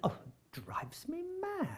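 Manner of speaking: high, hushed, drawn-out exasperation; retold exasperation. Actor recalling his honest answer to director, retold exasperation. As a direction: let it out slowly, high and under your breath.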